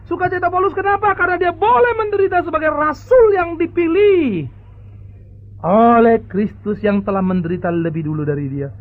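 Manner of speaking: high, loud preacher's climax; fiery preaching. Acted. Pastor exhorts the congregation about Paul's joy, fiery. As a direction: proclaim it from the pulpit.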